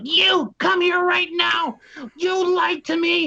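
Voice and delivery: high, drawn-out shout of an angry boss; irate shouting. Retold or imitated. Assistant director storms up to confront the impostor, irate high shout. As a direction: holler each word slowly, high-pitched.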